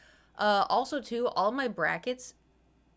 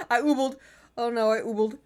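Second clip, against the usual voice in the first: loud, laughing through words; 3 semitones higher, 9 dB louder than usual. Real, not acted. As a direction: giggle it out. Laughing at her own word.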